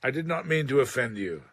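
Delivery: soothing apology. Acted. Johnson softens after insulting Boswell.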